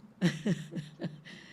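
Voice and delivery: short chuckle in quick bursts; real laugh. Real, not acted. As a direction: chuckle briefly before getting back to the topic. Host laughs before resuming her question.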